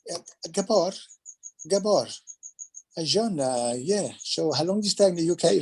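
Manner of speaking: imitating a French co-star's accent. French co-star asking about his UK stay, in a light French accent. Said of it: "Like, he's French".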